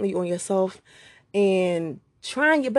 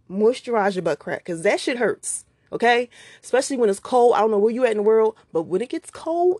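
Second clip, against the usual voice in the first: usual speaking voice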